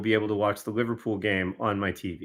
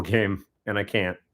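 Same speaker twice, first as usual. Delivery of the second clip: ordinary talk, nothing marked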